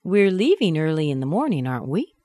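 "aren't we" rising at the end; lively statement, then "aren't we" swinging up; unsure tag question. Shown as a demonstration. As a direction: check the fact, lifting "we". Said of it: "Okay, I'm not sure".

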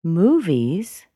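"movies" swept upward; rising intonation. Shown as a demonstration. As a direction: let "movies" climb and hang. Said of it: "went up".

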